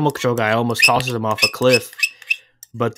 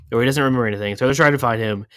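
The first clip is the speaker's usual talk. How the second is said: ordinary talk, nothing marked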